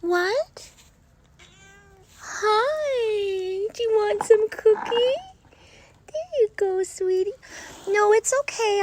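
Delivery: high cooing pet voice. Real, not acted. Talking to her cat in a high cooing pet voice.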